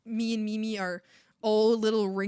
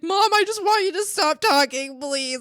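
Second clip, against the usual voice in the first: pleading outburst; 7 semitones higher, 6 dB louder than usual. Retold or imitated. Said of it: "I was just like".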